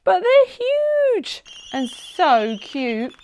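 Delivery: put-on girl cooing at fluffy chicks. A narrator's reading. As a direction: coo over the cute chicks. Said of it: "cooed Seb".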